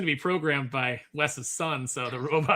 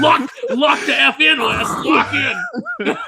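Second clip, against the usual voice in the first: high laughing yell of encouragement; laughing shout; 8 semitones higher, 10 dB louder, slower than usual. Real, not acted. Friend yelling at Wes to lock in, laughing hard. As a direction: cheer him on at full voice, cracking up.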